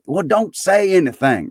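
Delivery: high, quick-to-interrupt Scrooge; eager retort. A narrator's reading. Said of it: "retorted Scrooge".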